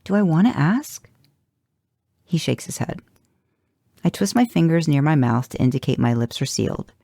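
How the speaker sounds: normal talking voice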